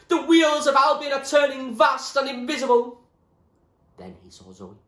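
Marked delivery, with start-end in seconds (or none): 0.1-3.0 high, loud raving shout; wild yell. Acted. William rants wildly at the top of the choir, shouting. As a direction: rant it like a madman. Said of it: "His shouts of nonsense".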